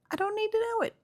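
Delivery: very high, squeaky insistence; high-pitched protest. Real, not acted. Vlogger refusing to hear about a business's religion, high-pitched protest.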